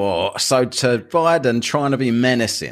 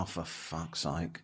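slow, much softer muttered groan; exasperated mutter; 6 semitones lower, 19 dB quieter, slower than usual. Retold or imitated. Host recalling his reaction to Kamala, slow fed-up mutter. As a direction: drag it out under your breath, fed up.

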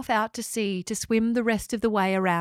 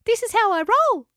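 much higher, bright small-creature voice; chirpy little echidna; 13 semitones higher, 6 dB louder than usual. Acted. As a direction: squeak out a happy farewell. Spike's cocky exit line.

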